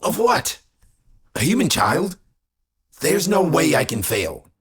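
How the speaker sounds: pitched high, incredulous and loud; affronted, high-voiced dismissal; scornful disbelief. A narrator's reading. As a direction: scoff at the very idea. Melthios scoffs at the warning.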